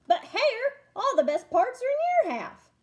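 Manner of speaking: high, loud protest; indignant bear. Acted. Bear protests to Hare over his pile, indignant. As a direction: whine that he's been shortchanged.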